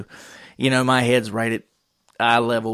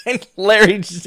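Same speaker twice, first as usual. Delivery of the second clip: ordinary talk, nothing marked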